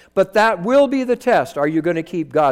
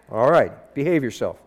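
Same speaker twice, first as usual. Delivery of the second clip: normal talking voice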